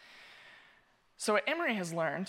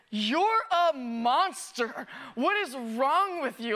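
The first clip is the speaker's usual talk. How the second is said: slow, louder mock horror, laughing; mock outrage; 3 semitones higher, 3 dB louder, much slower than usual. Imagined congregant on the couch judging the pastor, slow laughing mock outrage. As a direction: drag it out in shocked judgment, laughing through. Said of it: "You're like".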